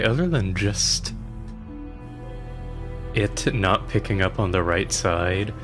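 ordinary talk, nothing marked

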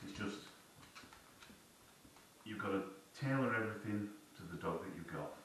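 ordinary talk

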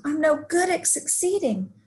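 high, exasperated character voice; mild acted yell. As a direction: wail it in mock frustration. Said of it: "Izzy yelled".

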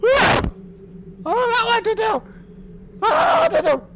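high panicked screams; panicking gamer. Real, not acted. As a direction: shriek it, totally lost. Monster right there, screaming.

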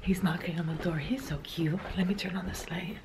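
soft unvoiced whisper, barely there. Real, not acted. Mom whispering as she sneaks to her son's room.

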